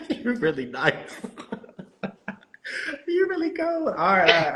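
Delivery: high, giggling compliments; laughing. Real, not acted. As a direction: laugh through the words. Laughing at reply.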